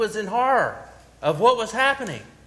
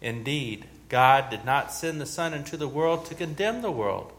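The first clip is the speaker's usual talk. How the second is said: measured, reverent Gospel reading, lower and softer; solemn scripture reading; 4 semitones lower, 4 dB quieter than usual. Acted. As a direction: read the Gospel to the congregation with quiet gravity. Reads the Gospel aloud.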